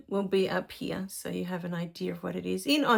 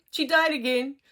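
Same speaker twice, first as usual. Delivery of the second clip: usual speaking voice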